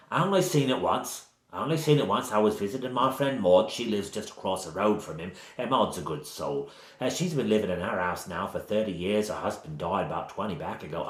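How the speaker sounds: rambling Cockney village gossip; bad Cockney accent. Village woman rambling about her friend Maud, in bad Cockney. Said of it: "she has a sort of bad Cockney accent".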